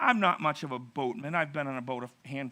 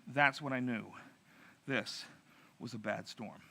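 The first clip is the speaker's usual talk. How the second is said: quiet admission; 5 semitones lower, 7 dB quieter than usual. Retold or imitated. Quoting sobered storyteller quietly.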